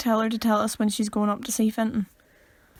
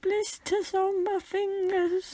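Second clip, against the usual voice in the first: quoting the film; 9 semitones higher, slower than usual. Retold or imitated.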